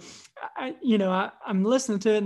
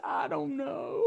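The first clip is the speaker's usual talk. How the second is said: higher, softer imitation of a tearful child; mock crying; 4 semitones higher, 8 dB quieter, slower than usual. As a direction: wail it softly as a confused little boy. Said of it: "he's kinda crying".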